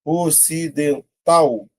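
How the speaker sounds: "Ocidental" slow by syllables, landing on TAL; stressed last syllable. Shown as a demonstration. Teacher showing the last syllable is tonic, syllable by syllable. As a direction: separate "Ocidental" and lean on TAL. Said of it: "Última sílaba tônica".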